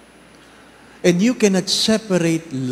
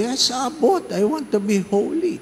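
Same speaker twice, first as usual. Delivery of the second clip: put-on eager young man; 10 semitones higher than usual. Young man eagerly answers abbot.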